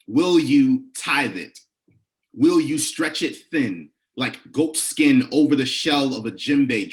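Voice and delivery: slow, rhythmic poetry performance with emphatic questions; measured, rising performance poetry; spoken word poem. Acted. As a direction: preach each line like a call to the congregation.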